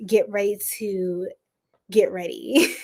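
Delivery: a flirty hushed whisper. Acted. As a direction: drop to a playful whisper on get ready. Repeated 'get ready', whispered.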